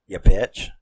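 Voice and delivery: loud, spat-out insult; angry curse. Retold or imitated. Viewer cursing the movie villain, a loud angry insult. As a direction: hurl it at the villain.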